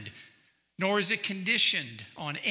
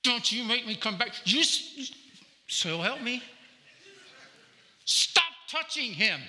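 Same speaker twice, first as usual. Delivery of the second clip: scolding parent; 8 semitones higher than usual. Retold or imitated. Parent scolding from front seat.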